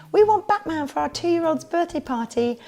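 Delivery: high put-on customer voice; mock client request. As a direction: imitate an eager parent booking a party. Voicing clients making a request.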